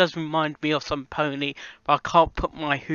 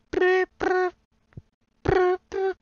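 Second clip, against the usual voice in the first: imitated high beeps; 13 semitones higher, slower than usual. Shown as a demonstration. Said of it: "D F tones".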